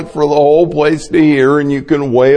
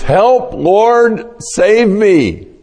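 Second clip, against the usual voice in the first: much higher, loud panicked plea; desperate cry; 10 semitones higher, 3 dB louder, much slower than usual. Retold or imitated. Peter sinking in the waves, crying to the Lord in panic. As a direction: call out like a drowning man.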